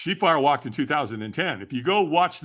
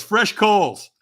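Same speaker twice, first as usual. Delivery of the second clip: louder, higher mock call; announcing; 6 semitones higher, 6 dB louder than usual. Tony calling for fresh coals, hammed-up announcement. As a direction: call it out like a showman. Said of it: "he goes".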